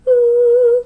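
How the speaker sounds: high falsetto ooh like a lady's voice; falsetto demo. Shown as a demonstration. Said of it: "falsetto is going to sound like".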